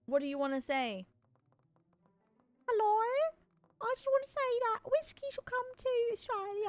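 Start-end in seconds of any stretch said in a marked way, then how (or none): 2.7-6.7 high squeaky caller voice. Pretend. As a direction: play a high-pitched call-in fan. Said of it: "I got a caller live on the phone".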